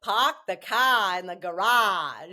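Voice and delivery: amateur attempt at a Boston accent. Guest who is bad at accents obliging the host, Boston accent. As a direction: have a go at Boston, dropping the Rs. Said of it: "In your best Boston accent".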